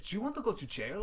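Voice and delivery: a hint of a stern threat; lightly voiced threatening official; mild menacing question; stern conductor. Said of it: "Like that".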